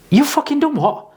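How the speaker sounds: can't believe what he just heard; shocked outrage. Retold or imitated.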